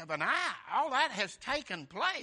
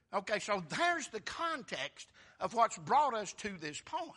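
ordinary talk, nothing marked

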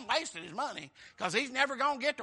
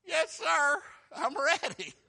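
high-pitched, loud comic compliance; hammed-up eager obedience; 7 semitones higher, 5 dB louder, slower than usual. Chastened Jonah answering God's second call, comically eager high voice. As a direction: snap to attention before God.

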